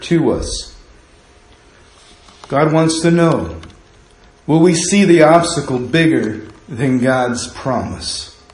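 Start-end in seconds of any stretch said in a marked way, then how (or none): none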